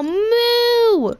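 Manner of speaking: playful cow noises going up; cow mooing. Pretend. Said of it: "I'm a cow".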